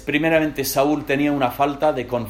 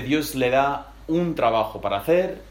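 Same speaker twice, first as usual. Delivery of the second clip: ordinary talk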